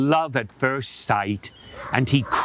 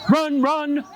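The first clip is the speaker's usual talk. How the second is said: shouted run; 11 semitones higher than usual. Shown as a demonstration. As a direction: yell it like a fleeing bunny.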